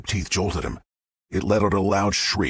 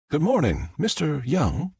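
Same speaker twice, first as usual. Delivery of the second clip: higher-pitched, smooth Southern voice; smooth Southern accent; 9 semitones higher than usual. A narrator's reading. Woman greets a stranger at his door, smooth Southern accent. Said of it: "asked in a smooth Southern accent".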